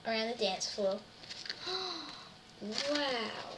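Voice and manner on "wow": awed. Acted. Awed gasp reading aloud.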